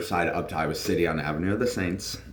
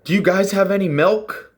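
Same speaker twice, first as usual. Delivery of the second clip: much higher, louder, bright mom voice; mom, oddly practical, hammed-up; 13 semitones higher, 7 dB louder than usual. Mom asking practical question.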